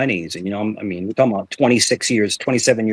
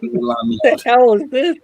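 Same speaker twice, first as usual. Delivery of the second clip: incredulous; 10 semitones higher, slower than usual. Retold or imitated. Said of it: "his own shocked reaction".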